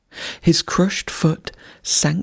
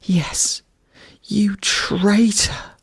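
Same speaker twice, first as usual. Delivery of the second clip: higher, slow, hissed "you traitor!"; hissed accusation; 5 semitones higher, much slower than usual. A narrator's reading. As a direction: hiss the accusation slowly. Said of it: "hissed the fox".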